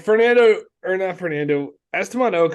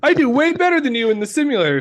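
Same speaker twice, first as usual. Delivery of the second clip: high, fast, bratty boast; bratty kid voice; 10 semitones higher, 3 dB louder, faster than usual. Retold or imitated. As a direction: brag like a spoiled child. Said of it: "mocking the driver".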